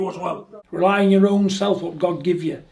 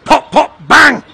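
gunshot noises; 6 semitones higher, 14 dB louder, much slower than usual. Retold or imitated. Mimics gunshots in rant.

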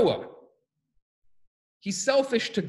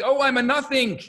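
high, raised-voice wail; mock screaming; 6 semitones higher, 5 dB louder than usual. Students late at night, mock screaming. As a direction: call it out loudly in imitation. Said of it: "screaming on either end".

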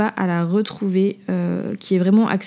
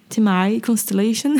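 name said with an attempted English accent; self-mocked English accent. Shown as a demonstration. Podcaster retrying a listener's handle, in a put-on English accent. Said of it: "avec mon magnifique accent".

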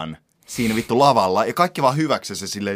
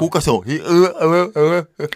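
mock robot stutter, higher and louder; glitching robot; 6 semitones higher, 4 dB louder than usual. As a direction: stutter like a broken bot. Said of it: "Facebookin robotti alkoi vähän sekoilemaan".

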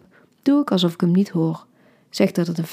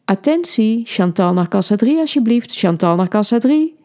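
louder, announcing over a pretend microphone; mock store announcement; 3 semitones higher, 5 dB louder than usual. Woman teasing her boyfriend, mock store announcer. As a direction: call it out like a supermarket PA. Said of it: "doe ik alsof het een microfoon is".

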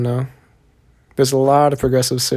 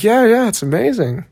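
higher-pitched, retold enthusiasm; enthusiastic agreement; 9 semitones higher than usual. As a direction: imitate hikers eagerly agreeing. Retold hikers agreeing about the view.

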